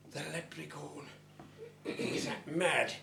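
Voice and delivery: ordinary delivery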